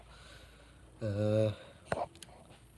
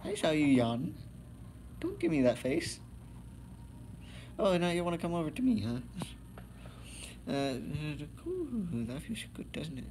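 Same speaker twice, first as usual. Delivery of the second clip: pet talk; 6 semitones higher than usual. Real, not acted. Talking to the pet.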